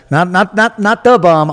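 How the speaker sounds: correcting the slang with a laugh in the voice; playful correction. Real, not acted. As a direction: bounce up on the nots to set it straight. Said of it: "I bombed it".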